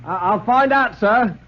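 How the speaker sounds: high, stammering butler voice; a flustered servant answering his master; startled butler. Acted.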